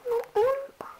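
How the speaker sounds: high whispered agreement. Pretend. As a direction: whisper back secretly. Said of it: "No tätä kuiskytte".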